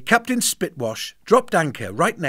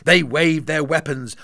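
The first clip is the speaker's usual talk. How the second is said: ordinary delivery, nothing marked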